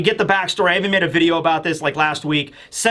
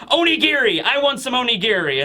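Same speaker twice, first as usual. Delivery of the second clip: put-on higher, exclaimed child character demand; acted kid's demand, pitched up; hungry kid demanding food; 5 semitones higher than usual. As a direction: call out for food like an eager kid. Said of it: "and he's like".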